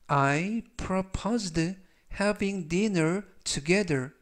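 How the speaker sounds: very slow, smooth and clear; 아주 천천히 매끄럽게 읽기; slow reading. Shown as a demonstration. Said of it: "천천히 세 번씩 읽어드리고요". (따라 하도록 천천히 이어 읽기)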